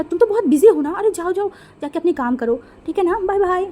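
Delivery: high, loud sing-song teasing. A narrator's reading. Friend teases Wenjing to make her stay, in a high, loud sing-song. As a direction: tease her, singing out bye bye.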